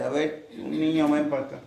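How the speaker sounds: a lightly voiced Jesus beckoning a child; calling a child. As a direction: call the little one to come here.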